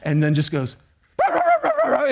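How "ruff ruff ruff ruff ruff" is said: very high yapping burst; frantic mock puppy barks; imitated dog barking. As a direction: bark back like a stubborn puppy. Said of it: "she just starts barking at me".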